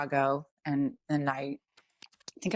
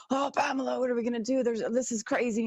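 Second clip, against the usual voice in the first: gasp, then much higher panicked husband; freaking out; 7 semitones higher than usual. Retold or imitated. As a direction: play the husband panicking by the locked car. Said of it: "He's, like, freaking out".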